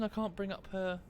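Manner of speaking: ordinary talk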